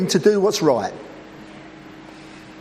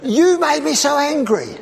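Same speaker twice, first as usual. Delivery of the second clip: mock accusation; 5 semitones higher than usual. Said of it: "forgive me, but".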